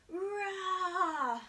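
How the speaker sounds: put-on teacher roaring like a lion for kids. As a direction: roar like a friendly lion. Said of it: "a big push-up and a roar".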